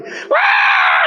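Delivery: put-on high squealing child's cry; a child's sudden tantrum wail, hammed up; preacher mimics a toddler's tantrum wail; mock child wail. Child bursts into anger.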